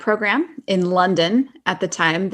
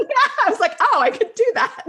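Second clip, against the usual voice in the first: laughing. Retold or imitated. Laughing retelling own reaction.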